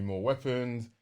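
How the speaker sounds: ordinary talk, nothing marked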